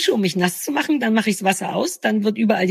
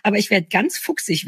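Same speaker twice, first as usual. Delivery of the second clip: ordinary talk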